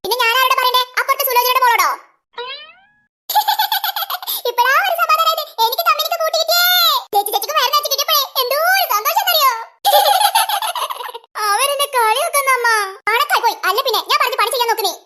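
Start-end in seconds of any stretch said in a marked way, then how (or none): 3.3-4.4 a high burst of giggling; high giggle. Acted. Girl laughs at her mother's joke, high giggle. 9.6-11.4 a long high laugh falling away. Acted. Sisters mocking Lachu with a long high laugh.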